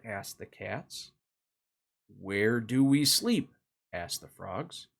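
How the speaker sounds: put-on gentle storybook narration for kids; reading to children. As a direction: read it like a bedtime picture book. Reads picture book to children.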